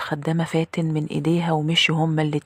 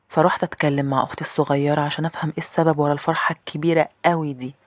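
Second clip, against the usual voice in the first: plain reading voice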